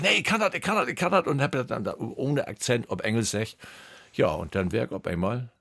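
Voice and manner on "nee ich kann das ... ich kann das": high, hurried embarrassed insistence; hoch, verlegen und hastig; flustered protest. Retold or imitated. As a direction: rush to insist, pitch jumping up. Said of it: "Ich sag".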